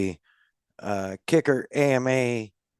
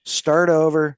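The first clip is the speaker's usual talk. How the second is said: emphatic; 4 semitones higher, 5 dB louder than usual. Acted. Echoing joke with emphasis.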